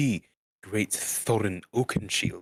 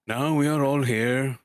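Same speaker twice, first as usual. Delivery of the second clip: loud, higher wizard announcement; Gandalf's voice; 6 semitones higher, 6 dB louder than usual. Acted. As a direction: announce to the party like a jolly wizard. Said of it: "said Gandalf".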